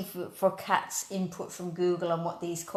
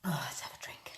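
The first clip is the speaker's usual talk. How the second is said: whispered aside; 5 semitones lower, 12 dB quieter, faster than usual. Real, not acted. Aside while sipping.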